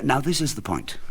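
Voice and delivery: plain reading voice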